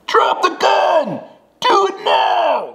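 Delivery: high, loud mock shout of an order; imitating yelling actors. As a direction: bellow it like the other guys in the audition. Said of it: "Like, just yelling".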